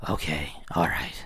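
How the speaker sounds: whispered okay, all right. Real, not acted. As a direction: breathe it out unvoiced. Whispered okay while preparing.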